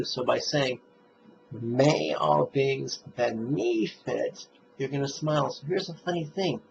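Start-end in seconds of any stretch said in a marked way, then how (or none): 1.5-4.5 slow, stretched "May" and second syllable of "benefit"; stress on MAY and beNEfit. Shown as a demonstration. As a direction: recite slowly, leaning into "May" and the NE in "benefit". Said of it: "you'll emphasize the second syllable of the word benefit".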